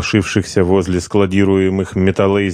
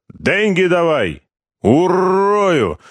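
much higher, slow menacing shout; threatening shout; 11 semitones higher, much slower than usual. Acted. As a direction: threaten to kill him. Corporal threatens partner.

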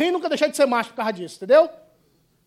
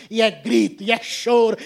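ordinary talk

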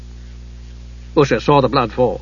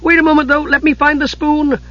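very high, piping character voice; high-pitched, fussing little voice; crying out eagerly; 13 semitones higher than usual. A narrator's reading. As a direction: pipe up high, begging a moment's delay. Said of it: "Tass cried".

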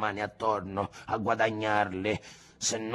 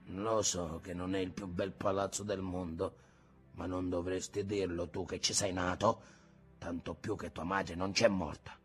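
much softer, lower, wounded; hurt, quiet rebuke; 6 semitones lower, 9 dB quieter than usual. A narrator's reading. A grandfather stung by his grandson's words, soft and low.

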